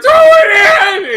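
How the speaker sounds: long, high screamed plea from ringside; loud, drawn-out high yell; Adrian's shout. Acted. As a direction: scream it out, stretching the words. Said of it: "And Adrian's like".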